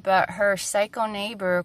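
normal talking voice

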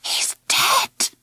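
hoarse, hushed whisper of dread. Acted. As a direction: whisper it as if afraid to say it. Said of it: "she whispered hoarsely".

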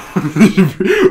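high real laugh after the Russian accent joke. Real, not acted. Laughing at his own nurse impression, high laugh. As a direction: crack up high.

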